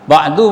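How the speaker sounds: ba'du with the final u kept short; short final vowel. Shown as a demonstration. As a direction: cut off the u in ba'du. (ba'du dibaca pendek, putus)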